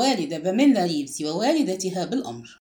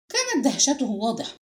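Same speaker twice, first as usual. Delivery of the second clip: plain reading voice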